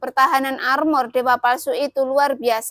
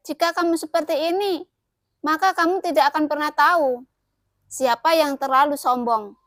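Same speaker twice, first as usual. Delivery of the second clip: ordinary delivery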